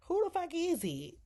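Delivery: high-pitched, brash mock demand; brash retort. Woman answering a banging at the door, brash. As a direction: snap it back high, imitating her. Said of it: "her with her language".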